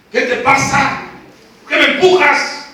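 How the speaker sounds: loud, indignant challenge; acted annoyed protest. Passer-by confronting blind man who pushed him, annoyed. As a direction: snap back at whoever shoved you.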